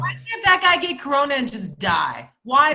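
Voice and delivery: angry rant. Real, not acted. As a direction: shout it at the guy in disgust. Ranting about the homeless man.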